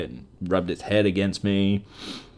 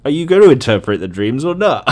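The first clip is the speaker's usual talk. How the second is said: louder, higher mock-frustrated demand; exasperated demand; 6 semitones higher, 8 dB louder than usual. Reading a useless dream book, louder exasperated demand. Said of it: "And I'm like".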